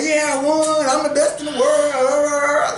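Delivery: high-pitched mocking boast, drawn out at the end; mock winner's boast. Mocking a predetermined winner to co-hosts, mock boasting. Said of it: "you be like".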